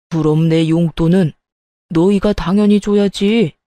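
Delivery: low-pitched first half, entitled; demanding father-in-law. Acted. Father-in-law demands his allowance from the couple, entitled. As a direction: play an entitled older in-law.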